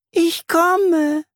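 very high, thin spider voice; high wispy voice. Acted. Spider answers from her web, high and wispy. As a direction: sing it out in a tiny high voice. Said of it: "Eine helle Stimme wispert".

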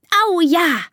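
high, delighted cheer; trumpeting elephant child. Acted. Elephant daughter welcoming the training, high trumpeting cheer. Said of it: "trompetet Dina".

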